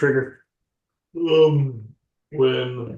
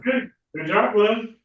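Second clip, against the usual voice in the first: pet praise voice; 10 semitones higher, 4 dB louder, slower than usual. Acted.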